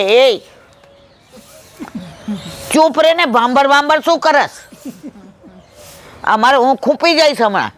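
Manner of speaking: the lion, annoyed and scared, shouting at the cow; lion scolding. Acted. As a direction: snap at the cow to hush.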